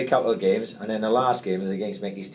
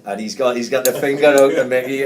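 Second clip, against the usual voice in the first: normal talking voice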